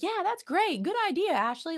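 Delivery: very high, gushing mock approval; sugary high fake encouragement; always-positive friend. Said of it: "it is not a friend that's, like, always like".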